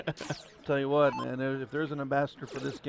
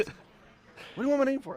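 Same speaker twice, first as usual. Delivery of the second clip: higher, louder mock-suspicious question; wary player; 11 semitones higher, 6 dB louder, much faster than usual. Player puzzled why the announcer wants her name, high mock voice. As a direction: playfully imitate a puzzled, guarded player. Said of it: "She's like".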